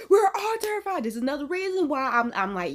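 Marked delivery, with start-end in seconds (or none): 0.1-1.0 higher, louder repeat of the punchline; emphatic. Acted. Reacting to ghost stories, emphatic higher repeat.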